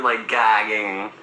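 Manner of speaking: usual speaking voice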